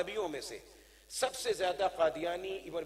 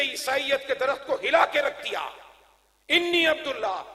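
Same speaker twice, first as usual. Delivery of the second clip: higher, emphatic triumphant declamation; ringing sermon climax; preaching at full force; 8 semitones higher, 7 dB louder than usual. Real, not acted. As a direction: hammer it home to the congregation. Preaches to a crowd.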